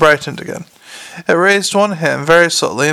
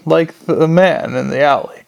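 usual speaking voice

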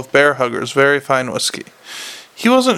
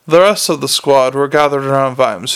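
ordinary delivery

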